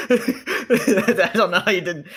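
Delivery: high, slow words through laughter; laughing. Real, not acted. Player baffled by friend's confusion, laughing. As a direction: struggle to speak while laughing.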